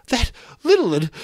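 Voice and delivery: put-on high, loud, breathless boy; gasping Piggy. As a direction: gasp it out, high. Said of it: "gasped Piggy".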